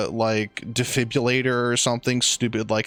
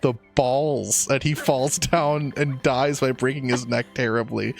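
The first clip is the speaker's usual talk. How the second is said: normal talking voice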